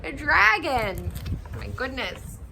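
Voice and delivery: squeaky high, loud startled bird voice; a bird shrieking at the crash; high loud birdy alarm. Acted. Birds react to dragon crash.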